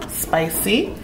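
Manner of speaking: normal talking voice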